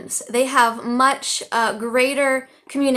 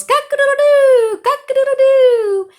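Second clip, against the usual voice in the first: much higher, louder crowing cock-a-doodle-doo with a laugh; loud rooster crow; 10 dB louder than usual. Shown as a demonstration. Teacher crowing like a rooster for her students, hammed-up. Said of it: "And I'm gonna try to say it".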